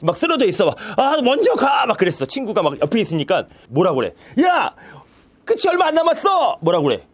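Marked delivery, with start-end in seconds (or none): 1.0-1.8 mock collapse plea. Acting exhausted friend. 4.4-6.6 high, eager encouragement; cheering on. Pretend. Teacher cheering a friend near the finish line, high and eager.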